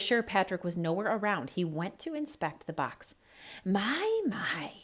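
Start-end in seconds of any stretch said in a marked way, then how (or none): 3.6-4.7 whispered mutter to himself. Acted. Snake alone admires the box, muttering to himself. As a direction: murmur it in private admiration. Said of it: "he muttered".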